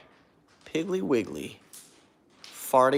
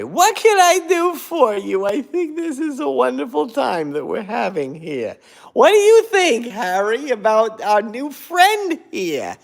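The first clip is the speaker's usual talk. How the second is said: high character voice; 14 semitones higher, 8 dB louder than usual. Shown as a demonstration. Answering a scam caller in a high, giddy put-on voice. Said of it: "answer it that way".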